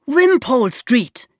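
usual speaking voice

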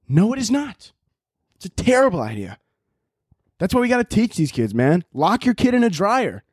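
ordinary talk, nothing marked

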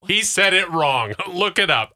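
high, emphatic mock outrage; indignant retort. Host retelling her Jeopardy protest, high indignant delivery. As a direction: protest it with comic certainty. Retold indignant reaction to contestant.

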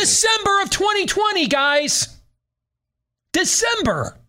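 "December" pitched very high; incredulous emphasis. Real, not acted. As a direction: hammer home "December" in a high, exasperated voice. Exasperated emphasis on the date.

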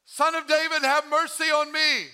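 high, loud sustained call; crying out. Acted. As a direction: shout a plea across a crowd. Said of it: "She didn't say".

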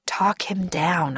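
slow, near-whispered thought; hushed inner thought. A narrator's reading. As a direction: think it aloud under your breath. Narrator's silent thought to himself.

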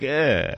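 short dismissive nasal eh; snooty eh noise. Retold or imitated. Mocking uppity women on a podcast, short snooty eh. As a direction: scoff it like a snooty girl. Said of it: "Whatever that noise is".

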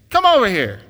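loud, high pushy parrot call; parrot's shouted summons. Acted. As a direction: holler him over as the joke's parrot. Parrot in joke calls customer over.

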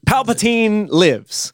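slow, high, dramatic announcement; dramatic trailer read. Acted. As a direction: read it like an epic movie crawl. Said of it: "the opening crawl".

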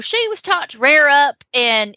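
casual Southern horse-owner voice; Southern accent. Acted. Seller admits the pony's habit of rearing, Southern accent. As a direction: drop it in with a Southern twang. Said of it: "it has to be Southern".

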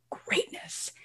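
breathy whispered greatness; hushed reverent whisper. Real, not acted. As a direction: breathe the word Greatness out unvoiced. Whispered aside after repeated word.

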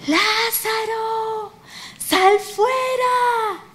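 long, high, drawn-out call from afar; Jesús calling out. Acted. Preacher as the voice calling Lázaro from the tomb, drawn-out shout. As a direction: hold each word high like a distant cry. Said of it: "Y Jesús grita, y a lo lejos se oye una voz".

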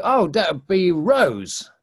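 put-on an old man slowly landing on the word; slow recalling. Old man finally names the flower, slow and drawn out.

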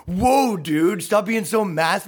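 hammed-up startled bro shout; mock alarmed whoa. Everyone mocks his masculinity.

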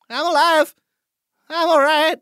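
high-pitched holler to friends outside; shouting back. Acted. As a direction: call back that you have survived.

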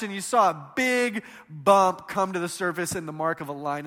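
ordinary delivery

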